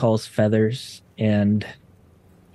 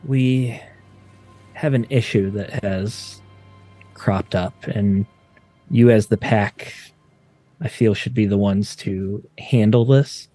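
lower, weary exasperated voice; exasperated Ebba; 4 semitones lower than usual. Acted. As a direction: sigh it out, fed up but worried. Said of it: "Ebba is a little more exasperated".

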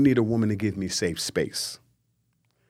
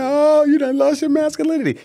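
high, loud mocking squeal at the crying groom; mock dismay; 7 dB louder than usual. Mocking a man crying at his own proposal, high loud squeal. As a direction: shriek it out in mock horror. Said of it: "I'm like".

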